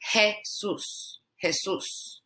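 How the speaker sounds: Jesus as heh-SOOS; Jesus with simple E; Jesus the Spanish way; Spanish pronunciation. Shown as a demonstration. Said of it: "is pronounced E".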